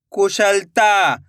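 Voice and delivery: slow, drawn-out blending of the word; slow clear reading. Shown as a demonstration. Teacher to pupils, blending the word slowly. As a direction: stretch each syllable for the pupils. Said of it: "एक एक अक्षर का उच्चारण सही से करना".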